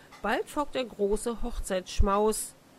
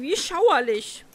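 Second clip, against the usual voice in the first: slow, louder, drawn-out shudder; shuddering disgust; 5 semitones higher, 6 dB louder than usual. A narrator's reading. Princess disgusted by the frog, slow shuddering words. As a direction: savour the horror slowly.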